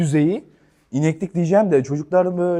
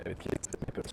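whisper; 16 dB quieter than usual. Real, not acted. Sneaking across the grass.

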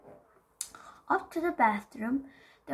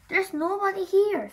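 put-on loud child voice; 9 semitones higher, 7 dB louder than usual. Cousin Fred voiced in story.

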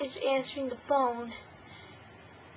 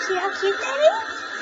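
squealing with delighted laughter; laughing; 11 semitones higher, 7 dB louder than usual. Real, not acted. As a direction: laugh out loud, high and excited.

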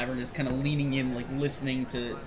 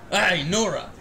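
calling across the room; 4 semitones higher, 6 dB louder than usual. Acted. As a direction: shout her name over. Bartender calls to Nora.

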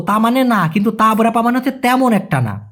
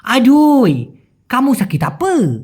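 higher, slow Malaysian exclamation and question; Malaysian slang drawl; 5 semitones higher, much slower than usual. Retold or imitated. Senior asking sick worker, in drawling Malaysian slang. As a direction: stretch the last vowel in Malaysian slang. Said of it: "আপা না বলে আপে, এভাবে".

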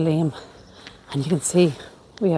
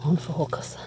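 whispering; 8 dB quieter than usual. Real, not acted. Filming remains, hushed at grave.

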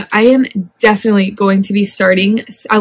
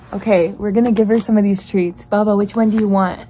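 ordinary delivery

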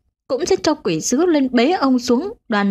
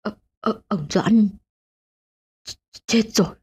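stammering in fear. A narrator's reading. Terrified husband stammers the news to his wife. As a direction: stumble over the words, frightened. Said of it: "ú ớ".

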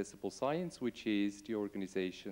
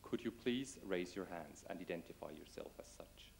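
soft unvoiced request to the audience; whispered request; 3 semitones lower, 7 dB quieter than usual. Real, not acted. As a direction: breathe the request almost silently. Asking audience to raise hands.

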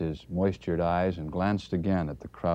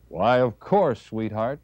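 automatic santa cheer; 5 semitones higher, 8 dB louder, slower than usual. Acted. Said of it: "he answered automatically".

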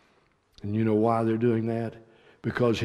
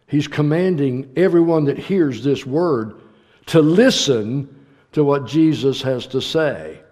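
rising, louder preaching; 2 semitones higher, 5 dB louder than usual. Acted. Preacher driving his command home to the congregation. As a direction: press the point home from the pulpit.

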